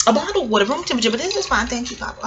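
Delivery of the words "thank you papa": very soft, sweet thanks; quietly grateful; soft thank you. Real, not acted. Thanking partner again.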